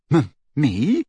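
startled stammer, rising question. A narrator's reading. Harry asked to be godfather, stammering. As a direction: stumble on the first sound in disbelief. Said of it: "stammered Harry".

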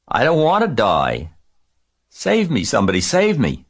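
high, loud plea for rescue; panicked screaming. A narrator's reading. Pig begs the barn to save him, panicked high scream. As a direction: cry out for help in rising terror. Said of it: "screamed Wilbur".

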